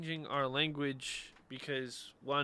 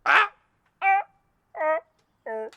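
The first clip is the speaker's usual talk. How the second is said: loud yells; 13 dB louder than usual. Real, not acted. Yelling, possibly to scare animals.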